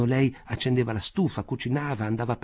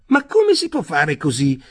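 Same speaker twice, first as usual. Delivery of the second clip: louder, higher alarmed cry; 5 semitones higher, 9 dB louder than usual. A narrator's reading. Rothschild pleads with Jacob, alarmed, louder and higher. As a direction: protest in sudden alarm. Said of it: "s'allarmò Rothschild".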